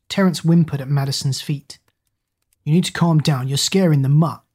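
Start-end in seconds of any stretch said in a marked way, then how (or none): none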